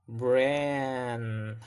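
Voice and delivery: "bran" drawn out, an uh glide before the N; relaxed vowel glide. Shown as a demonstration. Teacher shows the vowel followed by N, "bran" drawn out with a glide. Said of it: "as the back of the tongue relaxes".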